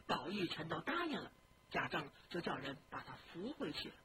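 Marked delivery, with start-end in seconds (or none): none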